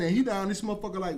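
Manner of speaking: ordinary delivery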